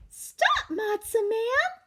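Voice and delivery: very high drawn-out call; Tilly calling after him. Acted. Cousin Tilly calling the matzah man back, high and long. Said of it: "called Cousin Tilly".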